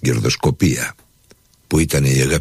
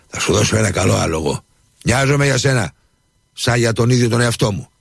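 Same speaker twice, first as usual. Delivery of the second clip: higher, persuasive salesman; persuasive dealer; 8 semitones higher than usual. A narrator's reading. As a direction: win the buyer over, higher. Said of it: "ξεφώνησε με εκείνο το ύφος που κατάφερνε να πείθει". (πειστικό ύφος εμπόρου, ψηλότερα)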